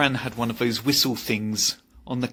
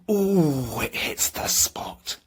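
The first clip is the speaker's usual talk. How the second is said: slow whispered satisfaction; breathy ooh of bliss; slower than usual. Pretend. Savouring tea before the audience, slow whispered bliss. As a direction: melt into the first sip.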